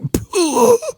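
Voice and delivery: high, airy "pooh" of punched-out breath; imitated breath forced from the lungs; wind knocked out. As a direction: let out a high winded puff like a gut punch. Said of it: "hear all the wind get knock-, knock-, knocked right out of his lungs".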